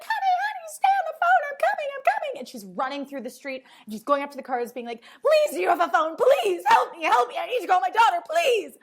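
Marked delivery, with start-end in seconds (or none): 0.0-2.4 much higher, louder frantic cry; mock panicked mom. Mom crying out to her daughter on the phone, frantic and high. Mother panicking in retold scene. 5.2-8.8 much higher and louder frantic pleading; mock desperate begging. Mom begging drivers for a phone, frantic and loud.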